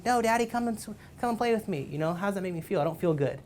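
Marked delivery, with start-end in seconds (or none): 0.0-1.9 put-on much higher child's voice, begging; a little kid tugging at dad; pleading toddler. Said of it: "he's like".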